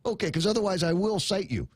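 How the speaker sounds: pretend cop. Host imagining an officer warning schoolkids, mock stern. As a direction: play a cop at the window warning kids. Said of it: "like this".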